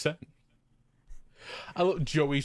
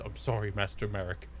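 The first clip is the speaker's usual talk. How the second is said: low, softer humble servant; meek apology; 6 semitones lower, 6 dB quieter than usual. Retold or imitated. Odion apologises to Master Marik, low and meek. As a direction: mumble it like a sheepish servant.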